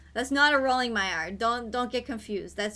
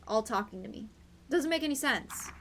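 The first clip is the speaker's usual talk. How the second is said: ordinary talk, nothing marked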